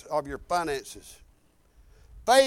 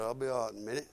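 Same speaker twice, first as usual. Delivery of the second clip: woozy self-reassurance; 9 semitones lower, 11 dB quieter, much faster than usual. Retold or imitated. Retells reassuring himself while woozy.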